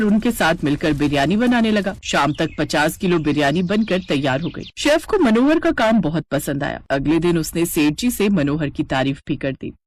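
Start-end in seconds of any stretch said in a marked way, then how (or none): none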